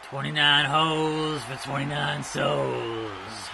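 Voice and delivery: slow, solemn dead man voice; slow graveside chant; slow Undertaker intone. Pretend. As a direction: intone it like the Deadman at a funeral. Undertaker voice while playing.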